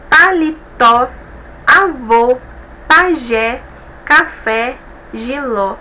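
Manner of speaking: "Paletó, avô, pajé, café, giló" slowly, one by one, final syllables stressed; stress last syllable. Shown as a demonstration. Teacher checking oxítonas, "Paletó, avô, pajé, café, giló" end-stressed. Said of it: "se a última sílaba é a mais".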